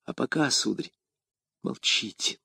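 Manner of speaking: very soft hush, ending in a whisper. A narrator's reading. Young woman urging the gentleman to keep silent, whispering. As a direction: hush them, voice nearly gone. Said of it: "прошептала молодая женщина".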